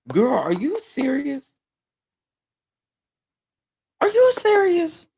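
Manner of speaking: incredulous. Retold or imitated. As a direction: build from slow doubt to a shrieking "are you serious". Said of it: "because I was just like".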